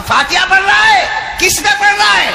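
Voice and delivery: a jinn questions the man at the grave, loud; jinn's challenge. Acted. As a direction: demand it like a towering jinn.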